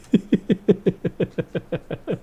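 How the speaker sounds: a sudden high-pitched burst of laughter. Real, not acted. Streamer cracking up at chat, high laugh.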